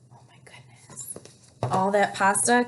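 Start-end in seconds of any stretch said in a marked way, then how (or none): 0.1-1.1 hushed awed whisper. Acted. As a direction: breathe it in wonder. Reader's awed aside to children.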